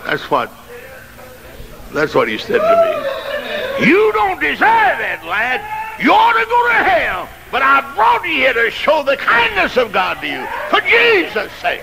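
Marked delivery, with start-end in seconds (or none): 2.6-7.3 preaching whoop. Acted. Preacher giving God's words to the church, high whoop. 7.5-11.8 high, shouted repeat of the line; preaching whoop. Acted. Same line whooped at full force.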